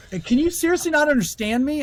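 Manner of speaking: begging to be understood; desperate plea. Retold or imitated.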